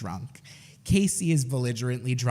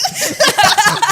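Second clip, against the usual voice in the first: loud laugh; 14 dB louder than usual. Real, not acted. Caught out, big laugh.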